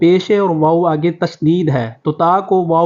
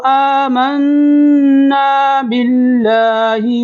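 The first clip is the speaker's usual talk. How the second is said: slow, high melodic recitation of ختم اللہ علی قلوبہم; model recitation; 7 semitones higher, much slower than usual. Shown as a demonstration. Teacher recites so students can listen carefully, slow melodic chant. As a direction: chant it slowly for students to copy. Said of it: "میرے ساتھ آپ بھی دہرائیں".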